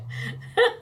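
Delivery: a high airy laugh; breathy laugh. Real, not acted. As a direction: laugh without voice at the memory. Laughs at her own story.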